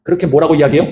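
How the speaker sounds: ordinary talk, nothing marked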